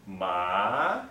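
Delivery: "Ma" starting low and sweeping upwards; "Ma" drawn out in a rising tone; second tone. Shown as a demonstration. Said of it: "moves upwards like this".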